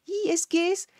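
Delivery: very high, slow, drawn-out gush; a very high, stretched cry of wonder; squealing amazement. Real, not acted. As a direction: squeal it out, overwhelmed. Overwhelmed by the singer's voice.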